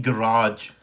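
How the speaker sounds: गैराज as guh-RAAZH, stressed on the long second syllable; American pronunciation. Shown as a demonstration. Teacher shows learners the American garage, American pronunciation. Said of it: "American में हो गया".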